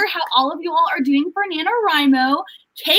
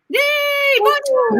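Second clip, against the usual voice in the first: high, bright-eyed greeting in mock French; excited bonjour; 12 semitones higher, much slower than usual. Real, not acted. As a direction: chirp a cheery bonjour.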